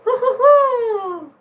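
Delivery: high, slow, loud hooting owl call; drawn-out owl hoots through a laugh. Retold or imitated. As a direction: hoot out like an owl. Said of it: "they actually mimic an owl".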